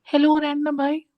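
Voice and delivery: put-on high, sweet girl's voice greeting; girly voice. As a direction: greet like a young girl. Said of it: "ये लड़की की आवाज में कौन बात कर रहा था". (लड़की जैसी ऊँची आवाज़)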